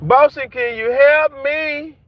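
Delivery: mimicking people calling for help, hammed-up; mocking desperate plea. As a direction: whine it like flood victims begging. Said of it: "They hollering".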